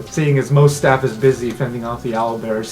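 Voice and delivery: ordinary talk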